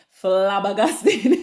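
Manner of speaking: slow, drawn-out word breaking into laughter; real helpless giggle at the end; laughing. Real, not acted. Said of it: "don't even know why I'm laughing".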